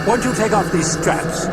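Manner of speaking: put-on high wheedling clipped British accent. A narrator's reading. Demon begging the priest to undo straps, wheedling British accent. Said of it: "wheedling voice in a clipped British accent".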